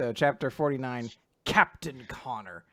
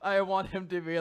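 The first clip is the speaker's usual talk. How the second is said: ordinary delivery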